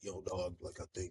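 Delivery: low-key, muttered street-tough talk; very soft, mumbled "yo, dog" persona; Bernthal. Retold or imitated. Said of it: "Bernthal's from. He sits down with a".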